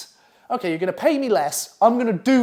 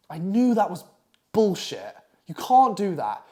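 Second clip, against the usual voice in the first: normal talking voice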